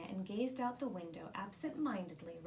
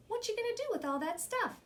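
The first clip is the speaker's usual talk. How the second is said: put-on child voice; 10 semitones higher, faster than usual. A narrator's reading. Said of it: "Tanya asked".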